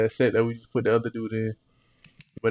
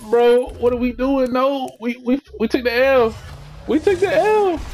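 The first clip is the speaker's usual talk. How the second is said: dismayed yell; 11 semitones higher, 3 dB louder than usual. Real, not acted. Bad pack pull, dismay.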